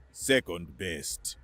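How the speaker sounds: slow low correction; dry, slow, low and quiet. A narrator's reading. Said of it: "Gavin corrected".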